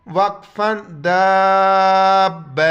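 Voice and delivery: وقفا داب stopped on داب, ta dropped; pause form. Shown as a demonstration. Teacher to students, demonstrates stopping on the word. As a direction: end on داب firmly. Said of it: "وقف کی حالت میں".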